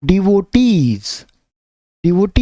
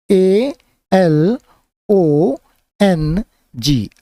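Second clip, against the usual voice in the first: slow spelling; much slower than usual. Shown as a demonstration. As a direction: spell A L O N G out letter by letter, very slowly. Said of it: "मैं spelling भी बोलते जा रहा हूँ".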